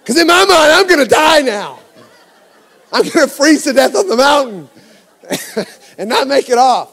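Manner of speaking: high, loud panicked rant; going off angrily. Retold or imitated. Dad sure he'll freeze on the mountain, going off loudly. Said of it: "And I'm going off on her".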